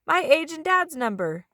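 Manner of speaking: put-on high kid voice blurting it out; eager call-out. A narrator's reading. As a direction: blurt the answer first. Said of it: "Eli called out".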